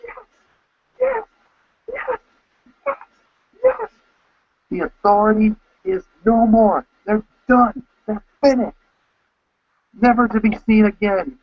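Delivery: crowing over the beaten Authority; bursting with joy. Retold or imitated. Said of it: "I was bursting into a".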